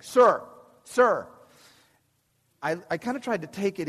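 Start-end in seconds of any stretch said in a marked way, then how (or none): 0.0-1.3 loud, firm officer calling sir; stern police officer. Retold or imitated. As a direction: call him awake with authority. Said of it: "police officer ... saying".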